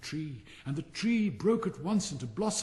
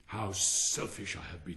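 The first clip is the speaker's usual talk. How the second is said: remorseful giant; 6 semitones lower than usual. Acted. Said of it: "the giant's heart melted".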